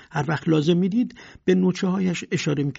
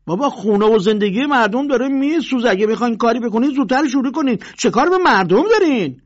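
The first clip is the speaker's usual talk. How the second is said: loud, high, urgent objection; exasperated shout; protesting; 8 semitones higher, 8 dB louder than usual. Acted. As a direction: push back on the bully, fast. Neighbours protest the bully.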